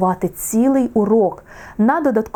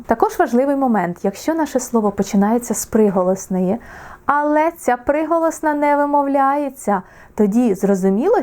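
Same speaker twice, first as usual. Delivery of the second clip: usual speaking voice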